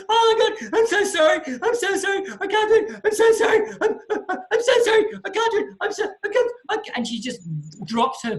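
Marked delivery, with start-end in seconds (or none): 0.1-7.0 very high, loud panicked wailing; hammed-up sobbing, gasping apologies over and over; hysterical meltdown, imitated. Student loses it in the plane, imitated hysterical wailing. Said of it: "And she loses it".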